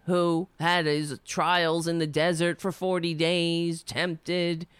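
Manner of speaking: usual speaking voice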